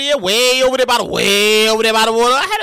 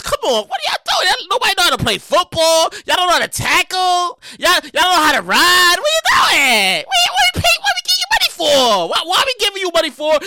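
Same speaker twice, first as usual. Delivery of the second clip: exasperated rant; 5 semitones higher than usual. Real, not acted. Ranting at the Giants losing.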